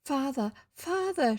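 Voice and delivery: high, slow cry for father. Acted. Emily finding her father gone, acted crying out. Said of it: "she cried".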